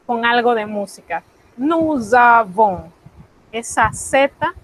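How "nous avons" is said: slow sing-song Nous avons with a buzzing Z; rhythmic liaison. Shown as a demonstration. Teacher chanting nous avons slowly, rhythmic liaison. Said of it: "con este pequeño ritmo".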